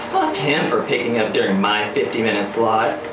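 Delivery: ordinary delivery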